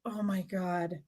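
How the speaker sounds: exasperated groan. Retold or imitated. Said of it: "and I'm like".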